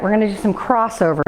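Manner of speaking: usual speaking voice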